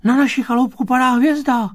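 very high, loud little-bug voice; alarmed bug children. A narrator's reading. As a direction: squeal it out to mum like tiny children. Little bugs alarmed by star.

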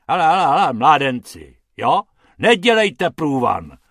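loud, irritated scolding growl. Acted. Pan Hrubec shooing two young fellows away, growling. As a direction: growl at them to clear off. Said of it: "zavrčel pan Hrubec".

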